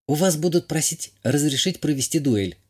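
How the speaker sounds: usual speaking voice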